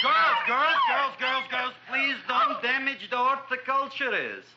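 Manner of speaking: high, loud calls over the din; loud high-pitched plea for order; frantic shouting. Acted. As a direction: shout to rein in excited customers. Shopkeeper shouts over excited girls.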